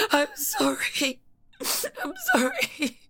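slow, broken, tearful sorry; sobbing sorry. Acted. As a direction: drag the apology out through sobs. Sobbing apology.

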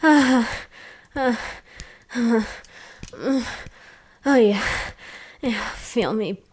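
loud, rhythmic moans; moaning. Acted. Moaning during acted intimacy.

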